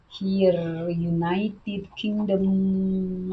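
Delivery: ordinary talk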